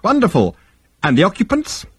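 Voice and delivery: high-pitched pleased coo; delighted psychiatrist. Acted. Psychiatrist hearing the ship has been found, delighted. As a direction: play the gratified therapist.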